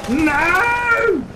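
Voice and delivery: drawn-out high "no"; high-pitched gamer despair; panicked wail. Real, not acted. Protesting at game danger.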